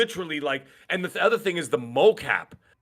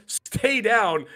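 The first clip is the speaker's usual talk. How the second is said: high, drawn-out mock command; mimicking a yelled warning, not at full volume; imitated yell; 6 semitones higher than usual. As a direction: order it like a game boss, pitched up. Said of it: "and then he yells".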